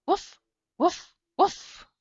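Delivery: high, loud woof woof woof like a dog; playful dog bark imitation. Shown as a demonstration. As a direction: bark it out like a big friendly dog. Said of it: "I make the sound".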